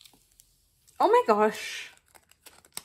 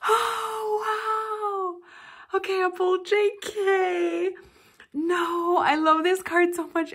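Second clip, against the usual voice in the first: high and loud, still thrilled; excited; 10 semitones higher, 7 dB louder, slower than usual. Real, not acted. Fan still gushing over the card, high and excited.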